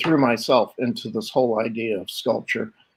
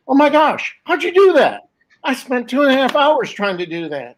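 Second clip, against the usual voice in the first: amazed disbelief; 12 semitones higher than usual. Retold or imitated. Son stunned as his father shapes steel, high amazed voice. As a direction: gasp it out like you can't believe it.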